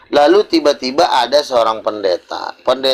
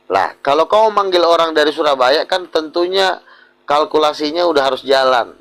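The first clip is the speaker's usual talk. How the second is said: ordinary talk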